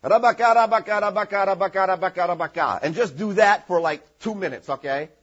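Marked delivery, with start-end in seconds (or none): 0.0-2.8 one nonsense word looped in a mock chant; mock repetitive tongues. Shown as a demonstration. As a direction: parody someone repeating one sound. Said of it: "somebody may do something like this".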